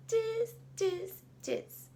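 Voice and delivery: high, squeaky monkey tiz; monkey chatter. Acted. Monkeys mock the peddler with high chatter. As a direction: chatter back like a cheeky monkey. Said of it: "the monkeys said".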